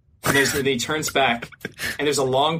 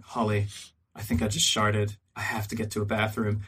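hushed confession; 4 semitones lower, 6 dB quieter than usual. Retold or imitated. Confiding accident to his wife.

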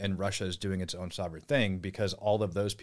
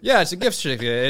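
louder, higher, grinning; amused; 8 semitones higher, 10 dB louder, faster than usual. Real, not acted. Confirming to the host, amused and louder. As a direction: sell the joke with a grin.

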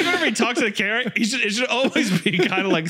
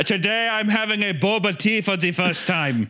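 put-on German-accented, upbeat video intro; Hitler as taste-test vlogger. Vlogger trying boba tea for viewers, chirpy German accent. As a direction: present it brightly to viewers.

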